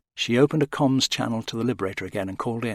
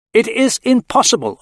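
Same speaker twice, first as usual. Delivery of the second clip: higher, firm, every word weighted; emphatic; 12 semitones higher, 9 dB louder than usual. A narrator's reading. Aurak refusing to help, emphatic. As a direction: insist it cannot be done. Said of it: "Aurak sounded emphatic".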